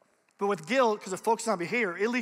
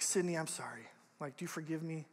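soft apology; 3 semitones lower, 9 dB quieter than usual. Retold or imitated. Son apologizes to sister.

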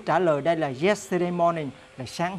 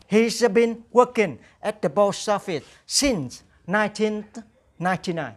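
slow, carefully articulated English; slow model sentence; 3 semitones higher than usual. Shown as a demonstration. Teacher reads the model answer slowly to students. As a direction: read it slowly so students can follow. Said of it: "teacher reads model sentence".

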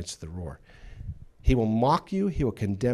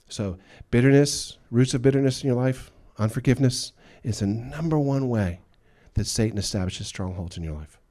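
preaching at full force; 3 semitones higher, 6 dB louder than usual. Real, not acted. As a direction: drive the warning home from the pulpit. Preaching hard.

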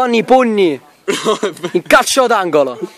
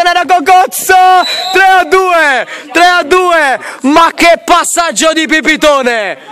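shouting a goal, much higher and louder; goal commentary shout; 9 semitones higher, 4 dB louder than usual. Real, not acted. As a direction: yell the goal call at full voice.

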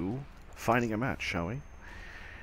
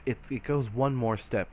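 usual speaking voice

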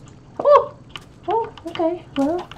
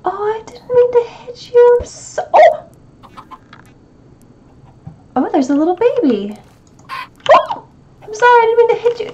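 high, fast sorry voice; apologetic; 8 semitones higher than usual. Real, not acted. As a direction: gush apologies at the animal. Apologizing to a baby animal.

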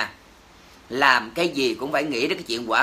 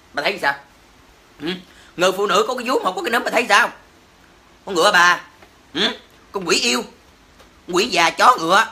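furious rant; 2 semitones higher, 3 dB louder than usual. Real, not acted. Streamer cursing a family who hurt a dog, furious shouting. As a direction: spit out curses in full fury. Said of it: "tôi quá trời nóng".

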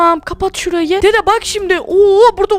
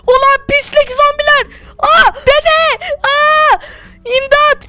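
panicked cry for help; 6 semitones higher, slower than usual. Acted. Zombies attack, grandson calls grandpa.

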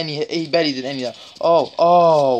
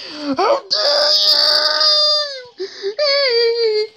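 very high, wailing cry of indignation; hammed-up wounded wail; mock outrage; 4 dB louder, much slower than usual. Real, not acted. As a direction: howl in fake betrayal.

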